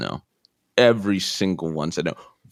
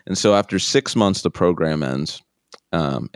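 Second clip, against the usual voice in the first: ordinary talk, nothing marked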